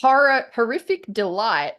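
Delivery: ordinary delivery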